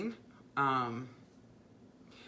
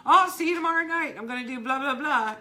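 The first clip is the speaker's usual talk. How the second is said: mock cheery promise; 10 semitones higher, 7 dB louder, faster than usual. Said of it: "I'll come on and I'll say".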